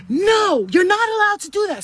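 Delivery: using your voice. Shown as a demonstration. Mother showing daughter how to refuse her friend, assertive. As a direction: demonstrate standing up for yourself, pitched well up. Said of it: "You use your fucking voice".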